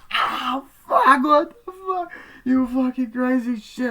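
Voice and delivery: high, loud shrieking curses; screaming in pain. Retold or imitated. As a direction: shriek as your fingers are cut off. Fingers cut off.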